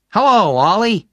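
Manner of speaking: high, loud swooping hello; hearty roommate greeting. A narrator's reading. Ray greets Oliver from the card game, heartily. Roommate hails him over cards.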